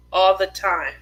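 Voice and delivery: ordinary talk, nothing marked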